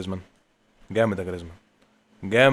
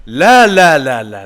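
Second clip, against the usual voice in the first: much higher and louder no; anguished shout; 3 semitones higher, 12 dB louder, much slower than usual. Real, not acted. Gamer yelling as the keeper denies him again, loud outcry. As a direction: yell no in despair.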